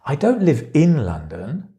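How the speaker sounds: "in" punched, with a rise at the end; stress on IN. Shown as a demonstration. Teacher showing how stress changes meaning, "in" stressed. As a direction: hit the little word "in". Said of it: "where you put the stress changes the meaning".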